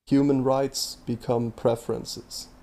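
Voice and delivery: normal talking voice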